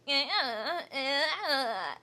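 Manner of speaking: creaky mocking influencer vowels; mock vocal fry. Vlogger making fun of how influencers sound, mock vocal fry. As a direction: imitate vocal fry as drawn-out wordless noises. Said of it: "some sort of accent that sounds like".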